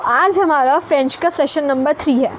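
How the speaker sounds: ordinary delivery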